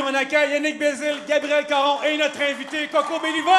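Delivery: high, loud show-opening shout; crowd hype shout. Real, not acted. Hosts hype crowd to open show. (cri d'animateur qui chauffe la salle)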